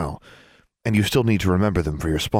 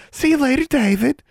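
suara tinggi melengking mengejek; high-pitched mimicry; 4 dB louder than usual. A narrator's reading. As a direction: meniru ucapan "See you later" dengan nada sangat tinggi. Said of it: "mimicked Dylan in a high-pitched voice".

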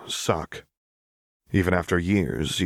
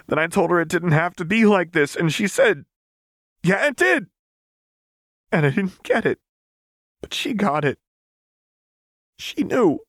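put-on higher, strained young woman telling it bitterly; Vi's voice; 12 semitones higher, 4 dB louder than usual. A narrator's reading. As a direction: voice Vi, raw and worked up over her sister. Vi's voice in the story.

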